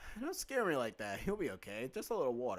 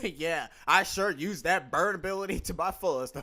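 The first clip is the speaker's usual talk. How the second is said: louder boast through laughter; laughing brag; 4 semitones higher, 8 dB louder than usual. Real, not acted.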